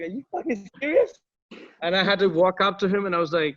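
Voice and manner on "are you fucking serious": much higher, imitating his own shock; stunned disbelief. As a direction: blurt it out in disbelief.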